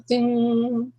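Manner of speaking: drawn-out high "ding"; ringing chord imitation. Musician plucks the C chord, sustained ringing ding. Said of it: "that's a vibration. It goes".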